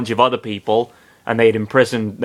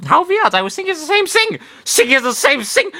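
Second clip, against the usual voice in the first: much higher, fast mock German; faux German accent; faster than usual. Popo agreeing eagerly, in a mock German accent. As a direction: rattle it off in a fake German accent. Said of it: "faux Teutonic accent".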